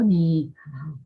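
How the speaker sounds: "Ni hao" with "Ni" kept down; first syllable left low. Shown as a demonstration. Teacher shows another reading that fails, "Ni" left low. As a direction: keep "Ni" down instead of rising. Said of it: "si yo lo dejo abajo".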